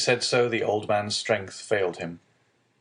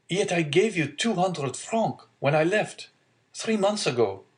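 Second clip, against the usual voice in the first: higher, lightly voiced puzzled young man; troubled young son; 9 semitones higher than usual. Son questioning father about money, mildly troubled. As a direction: gently press the point with lifted pitch.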